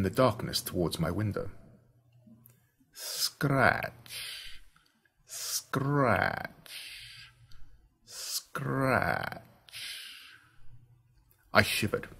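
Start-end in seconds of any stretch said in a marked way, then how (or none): 2.9-9.4 slow, drawn-out whispered "Scratch"; soft scratching. A narrator's reading. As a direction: let each scratch creep in the dark. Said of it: "soft and spine-tingling".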